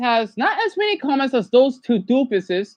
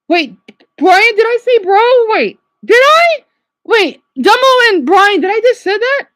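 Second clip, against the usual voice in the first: high, loud, alarmed questioning; shocked disbelief; 9 semitones higher, 6 dB louder than usual. Real, not acted. Speaker asking Brian about a word slip, shocked. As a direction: blurt it out, pitch shooting up.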